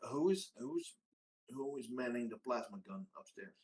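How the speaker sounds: normal talking voice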